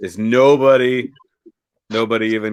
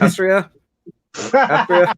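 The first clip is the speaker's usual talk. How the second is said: high mock call of a name; 8 semitones higher, 3 dB louder than usual. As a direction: play a visitor calling through a door. Acted calling at the door.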